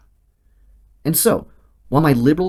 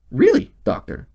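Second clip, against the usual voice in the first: higher, incredulous question; mock surprise. Patient answering the Canadian doctor, mock surprise. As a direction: act shocked at his claim.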